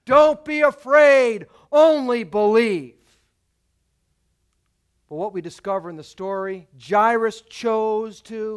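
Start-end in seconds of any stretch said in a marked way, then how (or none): 0.0-3.0 slow, loud and high reassurance; Jesus reassuring the frightened father; powerful reassurance. Acted. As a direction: speak it with calm power. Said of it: "simply and profoundly and powerfully says".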